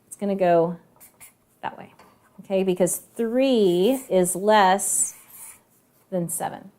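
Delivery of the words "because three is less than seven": slow, deliberate teacher voice; slow explanation. Shown as a demonstration. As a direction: lay it out slowly for the kids. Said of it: "teacher explains comparison".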